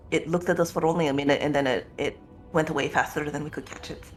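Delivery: ordinary delivery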